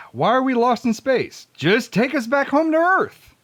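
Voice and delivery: ordinary talk, nothing marked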